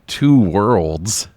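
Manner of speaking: ordinary talk, nothing marked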